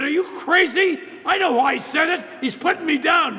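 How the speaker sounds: high, loud incredulous yell; comic exasperated shout; exasperated outburst. Retold or imitated. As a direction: blow up at the absurd advice.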